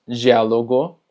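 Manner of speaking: diálogo as "jiálogo"; DI como ji. Shown as a demonstration. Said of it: "también se pronuncia ji". (diálogo con «ji» al inicio)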